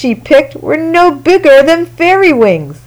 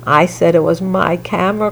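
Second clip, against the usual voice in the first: crying out softly; 6 semitones lower, 6 dB quieter, faster than usual. A narrator's reading. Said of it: "cried Ruthie".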